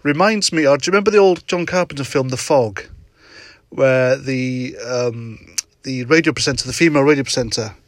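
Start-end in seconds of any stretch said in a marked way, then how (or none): none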